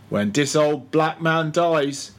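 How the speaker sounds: slow, drawn-out words; slowly. Acted. Old cook speaking of death to Stubb, slowly. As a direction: take your time over each word. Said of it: "said the negro slowly".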